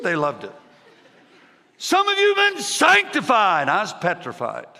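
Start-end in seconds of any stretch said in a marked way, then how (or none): 1.8-3.7 very high, loud preacher shout; yelling preacher. Retold or imitated. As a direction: holler it like a fired-up revival preacher. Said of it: "the more he yelled".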